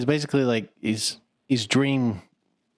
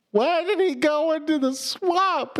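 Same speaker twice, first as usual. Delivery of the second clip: put-on high, slow little-kid question; high slow childlike whine; 13 semitones higher, slower than usual. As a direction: ask it like a bewildered child. Kid asking while watching.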